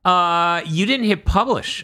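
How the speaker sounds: high, smug mock reply; mock prissy reply. Apple replies to the podcaster, high smug mock voice. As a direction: lift the voice high and act smug. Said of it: "And they're like".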